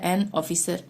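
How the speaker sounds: ordinary delivery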